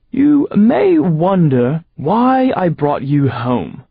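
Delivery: slow, loud posh English villain; slow high English-accented gloat; fancy English accent. Acted. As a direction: put on a grand English air. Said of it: "with his fancy English accent".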